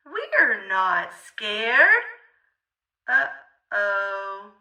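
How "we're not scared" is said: usual speaking voice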